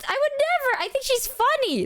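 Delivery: high-pitched, incredulous protest; indignant denial. Real, not acted. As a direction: protest it, voice shooting way up. Indignant denial of being mad.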